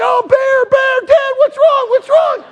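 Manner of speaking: put-on high, loud panicked kids' cries; alarmed boys. Boys woken in the tent to dad, alarmed. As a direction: blurt it in a high startled voice.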